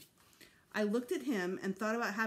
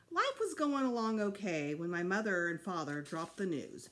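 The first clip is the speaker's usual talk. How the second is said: usual speaking voice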